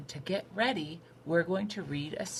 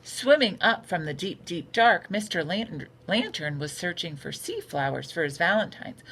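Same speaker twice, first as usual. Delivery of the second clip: bright, lively storybook read-aloud; a teacher reading a picture book to her class; reading to children; 3 semitones higher, 6 dB louder than usual. A narrator's reading. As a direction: read it like story time for little kids.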